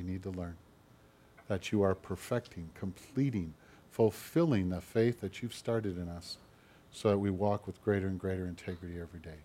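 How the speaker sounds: soft, low prayer voice, unhurried; closing prayer. Acted. Pastor closes the sermon praying softly for the congregation. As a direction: pray it gently over the people.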